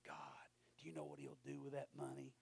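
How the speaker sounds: hushed whispered protest; pleading whisper. Retold or imitated. Preacher argues with God in a whisper.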